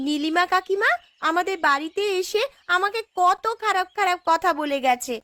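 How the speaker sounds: put-on high childish complaint; whining child. Little girl complains to the headman about Nilima, whiny and high. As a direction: tattle to the elder like a little girl.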